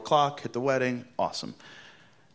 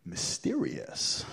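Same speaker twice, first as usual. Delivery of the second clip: spooky whisper; 2 semitones lower, 6 dB quieter, much slower than usual. Retold or imitated. Acting intrigue.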